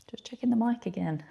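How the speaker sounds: quick whispered aside. Real, not acted. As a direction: whisper it under your breath. Aside while checking the mic.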